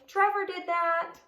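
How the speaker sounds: high, loud tattling pug voice; sneaky pug blaming. Acted. Naughty Pig blames Trevor in a high, loud whiny voice. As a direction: point the finger and tattle.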